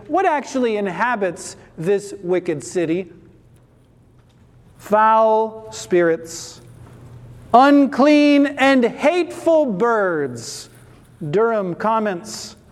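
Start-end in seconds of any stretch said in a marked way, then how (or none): 4.8-10.7 loud and drawn out, each word weighed; slow emphatic preaching. Real, not acted. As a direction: lean hard on every word.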